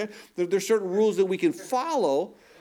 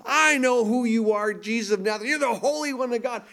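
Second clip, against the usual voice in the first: retold possessed man yelling, pitched much higher; mock demon scream; 8 semitones higher than usual. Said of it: "starts yelling, screaming".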